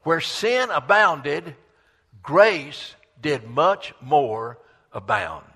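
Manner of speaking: slow, loud, stretched-out verse; declaimed scripture. Real, not acted. Preacher quoting a loved verse from Romans, slow declaiming. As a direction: draw out each key word emphatically.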